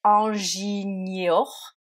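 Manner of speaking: ingenieur drawn out slowly, syllable by syllable; old Norman-French form. Shown as a demonstration. Teacher to French learners sounding out old ingenieur slowly. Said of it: "ça vient de ingenieur".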